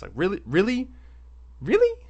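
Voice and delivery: incredulous. Retold or imitated. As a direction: question it in escalating mock outrage. Acted disbelief at being jumped.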